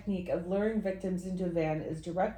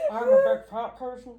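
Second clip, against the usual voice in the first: nasal villain voice; 6 dB louder than usual. Retold or imitated. Continues the nasal villain bit.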